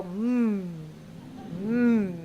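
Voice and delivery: low approving hum; closed-lip hum. Shown as a demonstration. Fallback for audience who forgot the words, closed-lip hum. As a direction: lips together, hum your support. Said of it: "put your lips together and go".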